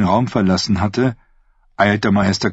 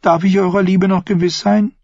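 put-on young woman's voice set higher than the narration, sweetly scheming; Thyene's voice; 10 semitones higher than usual. Thyene to her uncle the prince, sweetly scheming. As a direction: speak as the sly, innocent-sounding niece.

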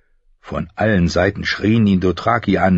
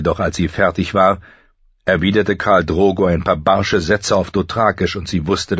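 ordinary delivery

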